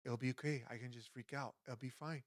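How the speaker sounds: very soft inner voice; hushed, acted self-soothing; quiet self-reassurance. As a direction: talk yourself down under your breath. Retells calming inner voice while panicking.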